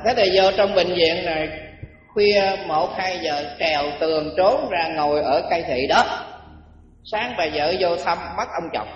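normal talking voice

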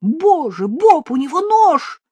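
high, loud alarmed scream of words; terrified cry. A narrator's reading. Jane warning Bob of a knife, panicked cry. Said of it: "Я услышал крик Джейн".

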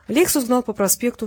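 usual speaking voice

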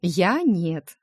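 ordinary delivery, nothing marked

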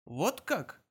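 taken aback, with a touch of confusion; mildly puzzled; slightly bewildered. A narrator's reading. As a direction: say it a little lost. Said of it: "с долей растерянности проговорил".

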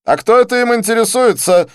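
high, loud, cutting question; sharp challenge. A narrator's reading. Didier challenges the stranger asking for Shantaram, sharp and high. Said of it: "резко бросил Дидье".

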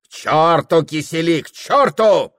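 high, loud, rising to a shout; rowdy dismissal. Acted. Host rejecting the wife's dessert, loudly dismissive. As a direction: wave it away noisily.

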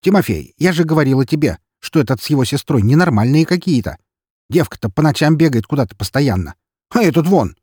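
lightly voiced nagging neighbour woman, building in pitch; indignant gossiping neighbour. A narrator's reading. Neighbour woman complains to Тимофей, indignant and scandalised.

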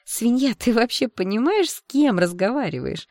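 put-on haughty mean-girl reproach; sharp. A narrator's reading. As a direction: demand it sharply, outraged. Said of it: "резко спросила одна из подружек".

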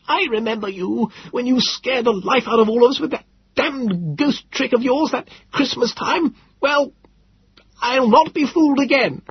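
put-on high, cracking old man's scorn; contemptuous insults. Frightened old Philby sneering at his rescuers, scornful. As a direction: spit each insult at him. Philby voiced accusing the narrator.

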